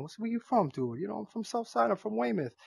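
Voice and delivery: thick put-on Boston accent, pitched high. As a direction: play a South Side Boston dude. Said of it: "Throw my Boston accent on".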